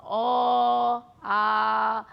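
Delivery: অ and আ sustained on a level pitch; open held vowels. Shown as a demonstration. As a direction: let অ, then আ flow on unblocked. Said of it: "কিচ্ছু আসছে না".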